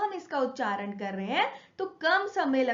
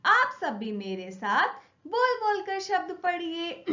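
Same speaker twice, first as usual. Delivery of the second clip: ordinary delivery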